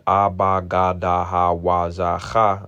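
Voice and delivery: "ah, ba, ga, da, ha, wa, za, cha" each crisp with an open ah; enunciated letter sounds. Shown as a demonstration. Teacher sounding the Hebrew characters for the class, crisply enunciated. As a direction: sound out every syllable distinctly. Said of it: "it's pretty much very enunciated".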